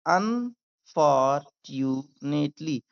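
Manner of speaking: "unfortunately" very slowly, un, for, tu, nately; syllable by syllable. Shown as a demonstration. Teacher getting learners to repeat, syllable by syllable. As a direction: break "unfortunately" into drawn-out pieces. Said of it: "मेरे साथ भी बोलिए".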